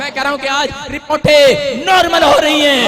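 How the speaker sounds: loud, rising sermon proclamation; fiery pulpit declaration; full-force preaching. Acted. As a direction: preach it out to the whole hall.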